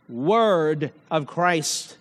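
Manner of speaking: "word of Christ" drawn out slowly, leaning on "word"; slow down and weigh each word of "word of Christ"; stress on word of Christ. Shown as a demonstration. Preacher stressing the phrase to the congregation, slow and weighty. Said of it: "I emphasized here".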